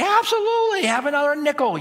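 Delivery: father happily handing his daughter another nickel, overjoyed; eager dad. Retold or imitated. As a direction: sing it out like a delighted dad. Dad gladly gives another nickel.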